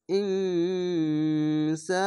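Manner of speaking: ইনসা chanted very slowly with the ন held as a nasal hum; ইনসা with a long nasal ghunna, very slow; nasal ghunna recitation. Shown as a demonstration. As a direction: hum the ন through the nose before সা. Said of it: "তার জন্য আমি হামজা পড়ছি".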